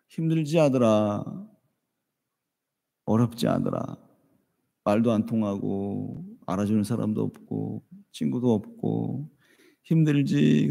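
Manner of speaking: soft, slow fatherly comfort; quiet tender father soothing his boy; tender consoling. Retold or imitated. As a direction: hush a crying son gently. Father comforting crying son.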